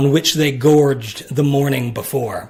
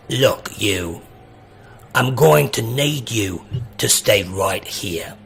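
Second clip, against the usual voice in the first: gruff order; 5 semitones lower than usual. Acted. Horned creature ordering the party to stay, low and stern. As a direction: lower creature voice laying down the rule.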